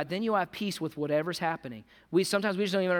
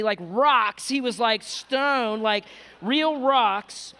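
fervent preaching; 5 semitones higher, 4 dB louder than usual. Acted. Pastor recounting Paul's sufferings, fervent loud preaching. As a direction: hammer each hardship home to the crowd.

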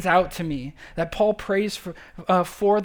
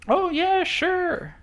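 mock cocky agreement; 11 semitones higher, much slower than usual. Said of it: "they thought".

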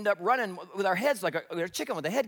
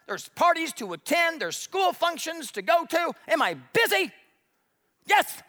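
loud, high, fed-up outburst; an overwhelmed parent snapping back; exasperated listener; 6 semitones higher, 4 dB louder than usual. Retold or imitated. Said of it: "You say".

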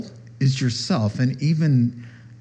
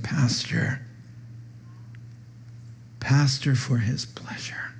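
hushed whisper of a friend's counsel; soft whispered pastor advice; 7 dB quieter than usual. Retold or imitated. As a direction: breathe it like a secret reminder. Voicing Peter's quiet counsel.

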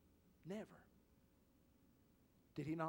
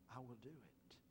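quiet; 10 dB quieter than usual. Acted. Quoting Jesus, softened.

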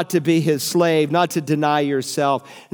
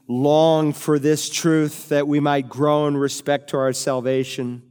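closing prayer; 2 semitones lower than usual. Acted. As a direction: pray it gently and reverently. Pastor leads closing prayer.

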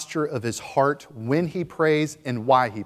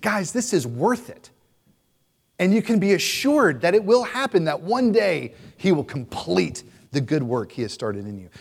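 Paul encouraging the church; 5 semitones higher, 4 dB louder than usual. Acted. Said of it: "He's like".

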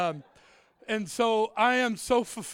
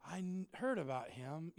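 put-on deflated drunk; 6 semitones lower, 11 dB quieter than usual. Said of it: "they're like".

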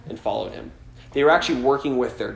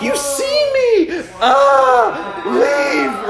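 loud, very high squeal of alarm; mock panicked shriek; 13 semitones higher, 8 dB louder, much slower than usual. Preacher acting Peter afraid of being seen, mock panicked shriek. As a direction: recoil with a hammed-up, drawn-out shriek.

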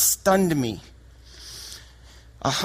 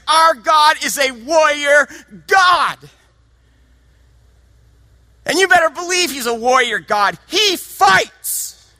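loud, high triumphant shout; proclaiming shout; 8 semitones higher, 6 dB louder than usual. Real, not acted. Preacher proclaiming God as warrior to the congregation, shouting. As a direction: ring it out like a battle cry.

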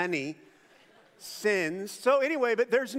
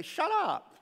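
mock hush; 10 semitones higher than usual. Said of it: "tell him to shut up".

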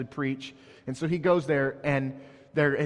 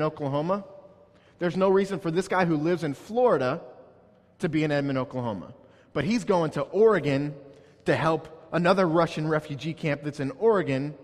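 raised, higher pulpit voice building the point; fervent preaching; 3 semitones higher, 3 dB louder than usual. Acted. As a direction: preach it to the congregation with fire.

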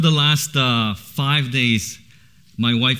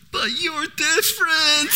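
put-on higher, slow childlike voice; imitating daughter; slower than usual. Daughter telling her dad he is different, childlike voice. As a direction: deliver it sweetly like a little girl. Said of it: "she goes".

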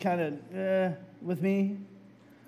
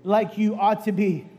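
rising, louder declarations, slowing; preaching; 3 semitones higher, 6 dB louder than usual. Acted. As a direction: build the proclamation to a peak. Preaching builds to climax.

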